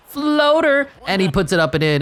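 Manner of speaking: real play-by-play excitement; excited call. Real, not acted. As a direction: sell the floater like a big moment. Calling the made shot.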